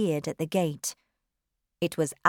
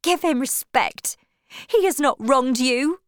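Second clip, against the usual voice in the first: high, raised, indignant woman; cried protest; 6 semitones higher, 4 dB louder than usual. A narrator's reading. Wife defending her husband to suitor, indignant. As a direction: demand respect in a heated voice. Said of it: "she cried".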